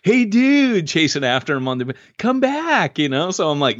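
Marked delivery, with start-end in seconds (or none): 0.0-0.9 high-pitched mock call. Jogger imagines chasing after the stranger, mock calling out. 2.2-3.0 high, drawn-out mock holler; mock calling out. Jogger playfully acts chasing the man down the beach, mock calling out.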